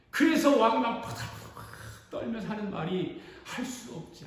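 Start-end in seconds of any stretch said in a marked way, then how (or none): 3.4-4.2 hissed resignation. Acted. King shaking with rage.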